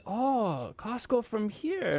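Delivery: high, slow imitation of a guest; imitated Kyoto host. Host mimicking the gal from Kyoto, imitated wonder. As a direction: draw it out high, as the impressed visitor. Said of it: "she was like".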